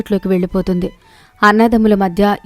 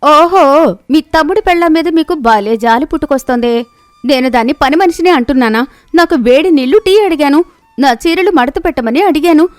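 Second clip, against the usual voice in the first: higher, mocking jealous wife; sarcastic sister-in-law mocking the husband's pity; sneering; 5 semitones higher than usual. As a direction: taunt him with scorn.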